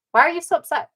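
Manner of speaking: high, pushy mock question; mock father's question. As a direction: needle him like the father did. Retold argument, voicing the father.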